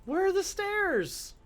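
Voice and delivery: high-pitched, drawn-out incredulous questions; slow, high-pitched baffled outburst; exasperated disbelief. Real, not acted. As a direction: squawk it in rising frustration at being lost. Lost, cannot find the stairs.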